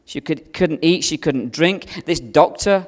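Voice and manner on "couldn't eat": usual speaking voice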